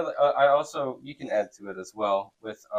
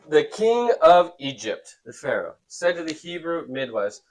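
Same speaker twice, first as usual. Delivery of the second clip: raised, emphatic read-aloud voice; scripture reading; 4 semitones higher, 6 dB louder, slower than usual. A narrator's reading. Teacher reads Pharaoh's story to the class, emphatic reading.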